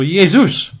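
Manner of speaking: Jesus said yay-ZOOS; Jesus in Greek, Y at the front, OOS at the end; Greek pronunciation. Shown as a demonstration. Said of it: "that's how the Greeks would".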